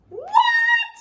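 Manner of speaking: shrieked high, startled granny; high astonished granny cry; cried. Acted. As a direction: squeal it like a shocked old grandma. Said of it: "cried Granny".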